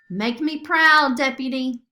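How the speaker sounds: rally your deputy; a commander's loud encouragement; rallying cry. Acted. Sophia rallies Flora.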